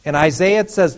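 usual speaking voice

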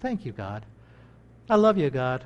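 hushed grateful prayer; quiet thanks. Retold or imitated. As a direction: murmur it like a private prayer. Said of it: "just say".

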